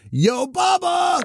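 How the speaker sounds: drawn-out high shout. Acted. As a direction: yell his name across the ship. Shouting for the assistant.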